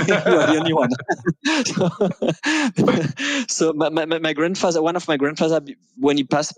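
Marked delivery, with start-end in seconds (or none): 0.1-0.9 laughing while speaking. Real, not acted. Modest reply after accent compliment. 1.0-1.6 a bashful high laugh. Real, not acted. Laughs at accent compliment. 1.9-3.4 a longer bashful high laugh. Real, not acted. Laughs at accent compliment.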